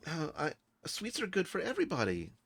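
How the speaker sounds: high-pitched mild protest; high character voice. Acted. Zale answering about sweets.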